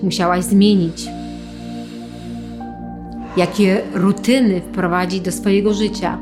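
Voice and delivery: calm them with long pauses. Acted. Leads a guided visualization.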